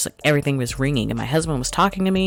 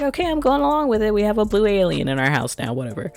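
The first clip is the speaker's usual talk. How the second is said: breezy shrug; 8 semitones higher, faster than usual. Retold or imitated. Voicing the mom character.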